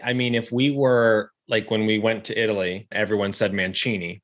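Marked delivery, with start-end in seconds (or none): 3.5-4.2 "Mancini" with a ch sound in the middle; Italian pronunciation. Retold or imitated. Guest recalling how everyone in Italy said it, Italian pronunciation of "Mancini". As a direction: say "Mancini" as man-CHEE-nee, as in Italy. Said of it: "which is how you would pronounce it".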